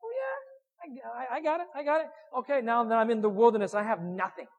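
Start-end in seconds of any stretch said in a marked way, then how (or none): 0.0-2.1 much higher, breezy and cocky; hammed-up falsetto bravado; mock overconfidence. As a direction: brush it off like you've got it all handled. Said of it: "for me, I was like".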